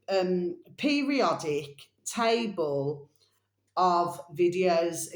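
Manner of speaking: slow, deliberate read-out for viewers; slow dictation. Shown as a demonstration. Host dictating a channel name for viewers, slowly. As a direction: spell it out slowly so they can type it. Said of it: "I'm gonna have to spell this out for you".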